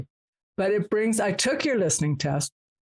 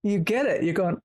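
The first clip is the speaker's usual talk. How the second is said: ordinary talk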